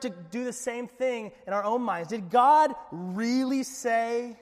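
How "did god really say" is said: mock doubting. Preacher voicing the tempter's doubt.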